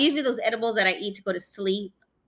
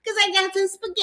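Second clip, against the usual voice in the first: mock Italian accent; 7 semitones higher than usual. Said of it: "What's with that dumb accent?".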